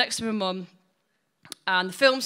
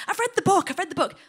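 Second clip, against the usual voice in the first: higher, eager insistence; excited mum; 9 semitones higher, faster than usual. Retold or imitated. Mum at cinema door, eager. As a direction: gabble it like an eager fan. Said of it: "looks at me and goes".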